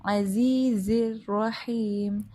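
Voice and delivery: slow chanted "عزیز الرحیم" from mid-throat; clear ain and ha. Shown as a demonstration. Teacher modelling "عزیز الرحیم", slow with clear throat letters. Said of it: "تو اس کو واضح ادا کرنا ہے".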